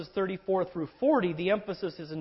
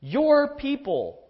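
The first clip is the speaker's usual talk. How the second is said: "Your" slowed and louder, pushed up high; stress on your; 8 semitones higher, 6 dB louder, much slower than usual. Shown as a demonstration. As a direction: hammer home "Your people" slowly. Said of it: "You see what the emphasis is here?".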